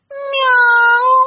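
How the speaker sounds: high, loud meow; cat meow. A narrator's reading. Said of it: "кто-то сказал".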